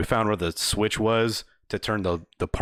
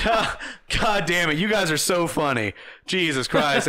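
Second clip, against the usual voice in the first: higher, laughing it off; amused disbelief; 7 semitones higher than usual. Retold or imitated. Workers laughing at the drunk customer, high and amused. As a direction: play it like a great joke.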